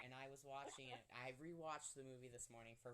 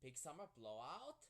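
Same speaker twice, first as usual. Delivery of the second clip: slow, drawn-out attempt at a cartoon accent; amateur imitation of Oaken's accent. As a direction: try a shopkeeper's foreign accent, slowly. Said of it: "With that accent, I'm not very good at".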